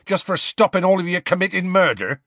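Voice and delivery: high, indignant giant's protest; bristling, offended retort; testy Hagrid. A narrator's reading. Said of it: "said Hagrid testily".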